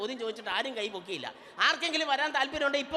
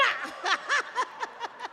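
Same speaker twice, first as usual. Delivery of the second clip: playful laugh; 9 semitones higher than usual. Acted. After the kiss on the bald head.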